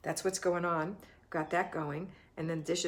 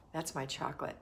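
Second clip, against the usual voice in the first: usual speaking voice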